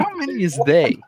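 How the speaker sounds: hint of a leprechaun Irish lilt; lightly voiced Irish leprechaun. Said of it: "starting to sound like a leprechaun".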